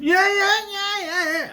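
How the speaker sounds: high, wailing comic scream; imitated scream. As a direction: wail out a mock scream, almost like E.T. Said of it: "I love his scream".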